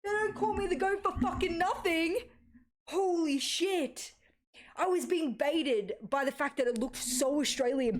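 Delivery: high, excited gloating; real victory gloat, pitched high; triumphant bragging. Real, not acted. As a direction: crow about the win. Gloating after the right guess.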